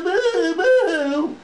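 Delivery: mock his whining; mock boo-hoo. Manager mimicking Brian's crying with mock boo-hoos. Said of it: "crying and complaining and boo".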